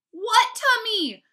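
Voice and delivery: high, slow, exasperated shout. A narrator's reading. Brother challenges Fudge's tummy claim, shouting. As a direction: shout it, drawing out both words. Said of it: "I shouted at my brother".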